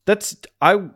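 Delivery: rising panicked shout; panicked shock. Retold or imitated. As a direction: realise in horror, voice climbing. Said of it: "he's like".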